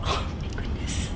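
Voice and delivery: breathy whisper of amazement; whispered oh my goodness; whispered aside. Real, not acted. Whispered aside at odd animal.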